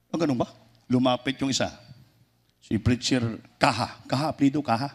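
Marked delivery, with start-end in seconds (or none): none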